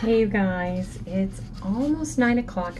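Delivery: ordinary talk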